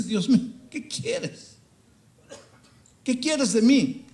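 high, loud, anguished cry to God. Acted. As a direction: cry out, broken, begging God. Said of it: "Y el profeta llora". (el profeta clama a Dios con angustia, en voz aguda y fuerte)

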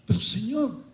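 high, pleading protest; protesting prophet. Acted. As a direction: cry out in disbelief to the Lord. (el profeta protesta en voz muy aguda)

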